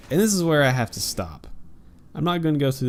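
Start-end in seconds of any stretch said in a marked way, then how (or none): none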